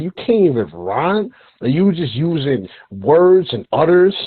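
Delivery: normal talking voice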